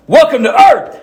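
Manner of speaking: high, punchy exclamation; mock-heroic shout; movie hero line. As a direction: call it out high, like an action hero. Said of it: "I was like".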